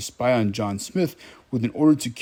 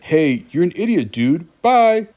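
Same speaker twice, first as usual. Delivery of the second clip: higher, louder retort ending on a big bye; mock brush-off; 5 semitones higher, 6 dB louder than usual. Pocahontas dumping condescending John Smith, mock indignant. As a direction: tell him off, then a loud, high dismissal.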